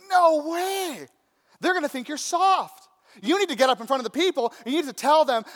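high, loud and fast protest; excited young buddy. Retold or imitated. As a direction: urge him on like a pushy friend. Buddy urging the king.

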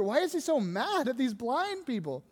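ordinary talk

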